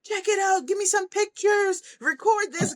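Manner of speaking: high-pitched imitation of thrilled kids; excited. Kids whose catapults worked calling the videographer, excited. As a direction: squeal it like kids showing off. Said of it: "they were excited".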